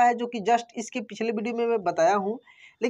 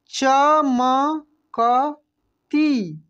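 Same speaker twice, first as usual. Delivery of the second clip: चमकती stretched very slowly, each syllable separate; 2 semitones higher, 3 dB louder, much slower than usual. Shown as a demonstration. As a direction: sound out चमकती slowly for the class. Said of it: "क्या पढ़ेंगे हम लोग?". (चमकती को अक्षर अक्षर धीरे बोलिए)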